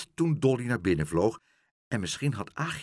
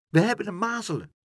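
put-on high, fast childish voice; children's voice; 10 semitones higher, 3 dB louder, faster than usual. The little Stampers tell Pluk about measles, high childish chatter. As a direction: chirp it like a small child.

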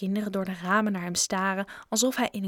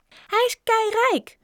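put-on very high, drawn-out child's call; slow squealed announcement; another voice shouting; 14 semitones higher, 4 dB louder, slower than usual. A narrator's reading. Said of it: "riep een andere stem". (hoog, uitgerekt geroep)

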